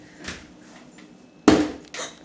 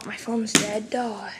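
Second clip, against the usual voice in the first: ordinary talk, nothing marked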